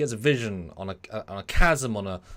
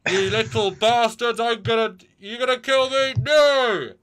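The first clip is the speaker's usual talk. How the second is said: much higher, louder, yelling in a put-on voice; angry yelling; 12 semitones higher, 10 dB louder, slower than usual. Freighter pilot shouting at the Scimitar crew, furious. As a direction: bellow at them like an outraged trucker.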